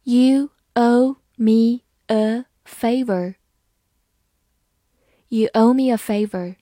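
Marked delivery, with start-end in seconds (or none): 0.0-3.4 very slow and a bit louder, every word clear; slow read. Shown as a demonstration. Teacher reading slowly to her students, slow and clear. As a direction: stretch it out for the class. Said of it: "好，慢慢来读".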